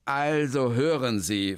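a hint of a flustered man; lightly voiced, hesitant man; Hermann. Hermann stumbles over his long new name, lightly voiced.

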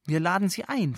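normal talking voice

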